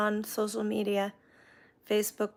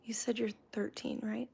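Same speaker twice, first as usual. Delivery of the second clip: whispered; 11 dB quieter than usual. Real, not acted. Reading a viewer's comment.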